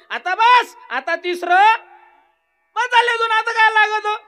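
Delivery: high, loud frantic mouse voice; pleading wife mouse. Acted. Wife mouse begs her husband to stop, high and loud. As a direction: beg him shrilly to stop at two.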